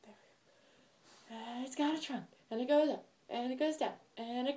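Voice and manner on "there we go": whispered. Real, not acted. Finishing the reveal.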